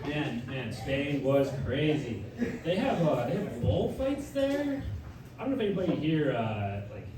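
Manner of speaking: ordinary talk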